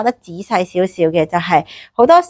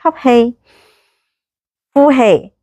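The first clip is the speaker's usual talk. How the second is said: slow breath counting; 4 semitones higher, slower than usual. Shown as a demonstration. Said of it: "試下運用我哋個呼吸".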